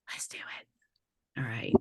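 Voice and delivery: soft slow unvoiced whisper; slow hushed whisper to the class. Real, not acted. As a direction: breathe it like a secret. Whispered aside after timer start.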